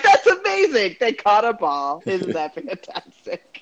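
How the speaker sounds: high, loud mock wonder, then drawn out; sarcastic amazement. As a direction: gush sarcastically over nothing.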